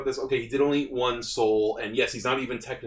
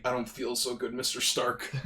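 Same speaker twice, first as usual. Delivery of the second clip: quoting famous line softly; 3 semitones lower, 8 dB quieter than usual. Retold or imitated. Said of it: "He did literally".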